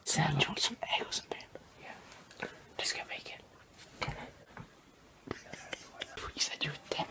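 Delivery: hushed whisper so no one wakes. Real, not acted. Filming early morning beside sleeping people, whispering. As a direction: breathe it, barely voiced.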